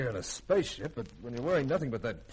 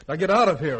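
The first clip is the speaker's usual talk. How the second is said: much louder, a furious final order; 4 semitones higher, 11 dB louder than usual. Acted. Commander ordering the girl out of the area, loud and angry. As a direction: drive her out with one loud command.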